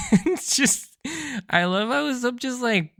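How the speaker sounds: high laughing talk. Real, not acted. Reviewer laughs at Usopp's return. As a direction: laugh through the words.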